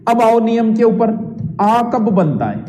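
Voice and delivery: ordinary talk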